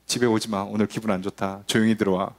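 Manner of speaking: low-pitched, confidential heads-up; discreet warning. Acted. Child warning siblings about mother's bad mood, low and discreet. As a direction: keep it low, tipping someone off.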